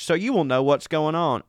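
ordinary talk, nothing marked

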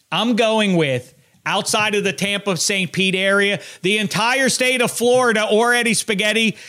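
high, loud, slowed sportscaster proclamation; emphatic announcer delivery, pitched well up; big reveal announcement. Acted. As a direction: build to the verdict and proclaim it. Big announcement of the chosen city.

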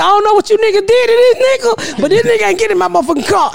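high then fast refusal; retold yell. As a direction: lay into them, fast. Yelling at supervisor.

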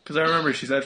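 ordinary delivery

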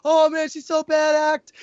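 high, slow awed praise; gushing. Retold or imitated. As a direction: savour every word in admiration. Fan gushing over Sabine.

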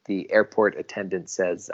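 ordinary delivery

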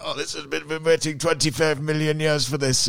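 high, smug put-on fan voice; sneering impression of a gloating away supporter; mocking a cocky fan. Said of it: "Is that what they sound like?".

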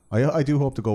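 ordinary talk

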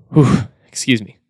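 tired, worn-out voice; voice giving out. Real, not acted. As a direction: voice going hoarse and failing. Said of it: "losing my voice a little bit".

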